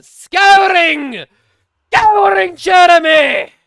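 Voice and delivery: high, drawn-out bellow; exasperated shout. Real, not acted. Streamer protesting to a heckling friend, exasperated shout. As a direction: bellow it with the key word stretched long.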